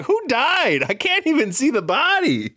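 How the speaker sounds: high-pitched baffled outcry; comic mock despair; exasperated confusion. Acted confusion at unseen death scene.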